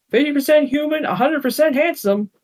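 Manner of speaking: high, cocky quip; teen boy showing off to the family; quipping. Acted. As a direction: toss it off with a grin. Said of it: "quipped Boris".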